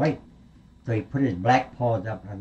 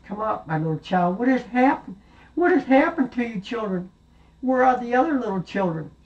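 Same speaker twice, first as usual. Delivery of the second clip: anxious mother; 11 semitones higher than usual. Acted. Mother questions surviving goat.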